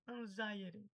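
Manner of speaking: "anxiety" as an-ZAI-eri. Shown as a demonstration. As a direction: say "anxiety" with Z and tapped T. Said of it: "o X de Z". ("anxiety" com X de Z, T de R)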